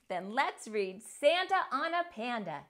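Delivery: ordinary delivery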